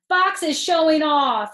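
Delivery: high, loud whiny complaint; tattling kid. Acted. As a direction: tell on him to the teacher.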